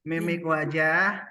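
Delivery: slow, drawn out, rising at the end; leading a drill. Shown as a demonstration. Trainer asks participants to follow along, leading a drill. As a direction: stretch it out for the group to echo. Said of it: "ikuti saya".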